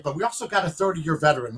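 normal talking voice

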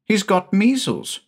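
put-on a boy bubbling about his plans; high quick child's voice; excited little boy.

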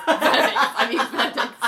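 slow, loud, laughing correction; laughing at the judge's blunder; laughing quote. Retold or imitated. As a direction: laugh through the slip. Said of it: "And then she says".

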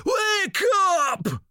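piercing high scream. A narrator's reading. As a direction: shriek it in his face. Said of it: "and screams".

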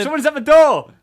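loud, high shout; high-pitched alert yell; calling out. Pretend. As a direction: shout it out over the alarm. Calling out over alarm.

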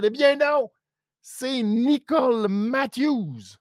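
beaucoup plus haut et plus fort, Nicole étirée lentement; annonce triomphante. Real, not acted. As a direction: lance le nom comme une révélation de gala. Révélation de l'adversaire surprise. (révélation enthousiaste, haute et lente)

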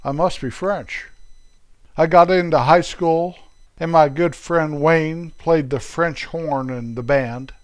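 ordinary delivery

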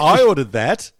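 ordered pushed up high and loud; mock excited. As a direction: claim the plate with eager glee. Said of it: "your sort of twig's like".

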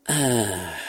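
long, falling sigh of relief; drawn-out "ah". A narrator's reading. Astronaut finally relieving himself in the capsule, drawn-out "ah". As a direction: let a long "ahhh" slide slowly downward. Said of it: "a drawn-out".

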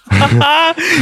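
a burst of high laughter. Real, not acted. Laughing at his own fantasy booking, high.

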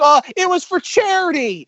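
high, goofy excuse; a high, lightly voiced goofy excuse; mock excuse. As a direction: toss off the excuse like a dopey punchline. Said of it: "It's like".

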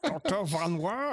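hammy fake German accent, high and slow; exaggerated comic German accent; mock German accent. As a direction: ham up a bad German doctor accent. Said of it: "very poor accent".

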